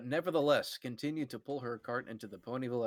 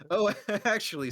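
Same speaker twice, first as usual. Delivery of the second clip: laughing; 9 semitones higher, 3 dB louder than usual. Acted. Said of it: "said Gage".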